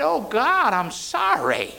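rising cry then slow apology; Jonah pleading. Retold or imitated. Preacher voicing Jonah's apology to God, pleading cry. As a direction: beg forgiveness, the words drawn out. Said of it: "He was saying".

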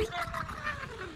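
high squealing laugh. Real, not acted. Preacher laughing at his own joke, a high squeal.

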